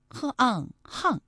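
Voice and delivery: 巷 broken into h and àng, then hàng; hàng sounded out. Shown as a demonstration. Host teaches listeners the second reading, 巷 sounded out as hàng. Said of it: "还有一个读音啊是".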